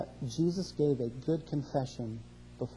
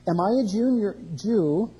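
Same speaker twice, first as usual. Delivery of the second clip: indignant retort; 4 semitones higher, 4 dB louder than usual. Retold or imitated.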